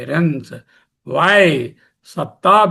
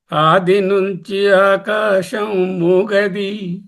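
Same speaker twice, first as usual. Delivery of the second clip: poem recital; 3 semitones higher, slower than usual. Acted. Recites a poem mid-lecture.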